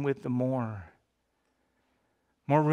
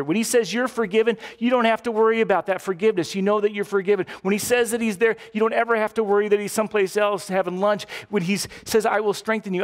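higher, louder preacher voice rising to a pitch; full-force preaching; 5 semitones higher, 4 dB louder than usual. Acted. Pastor preaching to the congregation on God's faithfulness, loud and fervent. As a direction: drive each promise home to the congregation.

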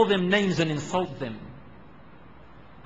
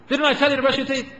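put-on sharp, high, loud nagging at a child; high-pitched parental telling-off; exasperated parent scolding; 8 semitones higher, 6 dB louder than usual. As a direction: scold them like a fed-up mum. Parent scolding child ignoring instructions.

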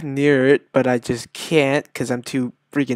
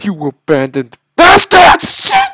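loud curse leaping into a shriek; high-pitched rage; 5 semitones higher, 7 dB louder than usual. Real, not acted. Gamer cursing the developers, high-pitched rage. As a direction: curse till the voice squeals. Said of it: "I'm getting all high-pitched and shit".

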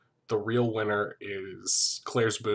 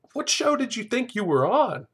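high, incredulous scolding; mock outrage; 11 semitones higher, 4 dB louder than usual. Host scolds the shocked conservative contestant, in mock outrage. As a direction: exclaim it in high-pitched disbelief.

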